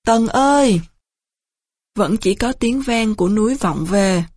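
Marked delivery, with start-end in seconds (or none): 0.0-0.8 drawn-out storyteller's call; mild calling shout. A narrator's reading. Trần Triện calls out for a missing comrade, restrained shout. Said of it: "gào thật to".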